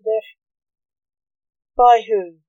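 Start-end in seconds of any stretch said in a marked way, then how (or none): 1.8-2.4 nervous nurse, pitched up slightly and louder; nervous question. A narrator's reading. Nurse questioning the doctor who accuses her, nervous. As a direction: ask it with a jolt of nerves. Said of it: "asked the nurse nervously".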